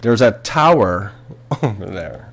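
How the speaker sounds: slow, drawn-out American; mock American accent. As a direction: put on a slow American voice, half laughing. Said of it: "the Americans would be like".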